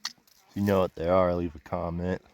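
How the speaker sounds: talking around a dip in the lip. Real, not acted. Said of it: "If I sound weird, it's 'cause I got a dip in my mouth".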